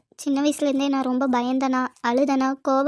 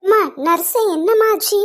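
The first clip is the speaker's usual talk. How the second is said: louder, higher urgent call to the nurse; anxious call; 5 semitones higher, 6 dB louder than usual. Acted. Anxious grandmother calls the nurse for news, loud and urgent.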